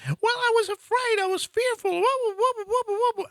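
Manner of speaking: high falsetto sobbing wail, hammed-up; mock a panicked woman bawling down the phone; imitating hysterical crying. Scared passenger sobbing to her driver on the phone, high mock wail. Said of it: "she cried and moaned and groaned".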